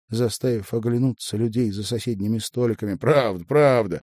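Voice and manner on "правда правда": loud, lightly amused reassurance; amused chuckle. A narrator's reading. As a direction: confirm it with an indulgent smirk. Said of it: "усмехнулся он". (с лёгкой усмешкой, громко)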